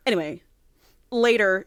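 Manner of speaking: ordinary delivery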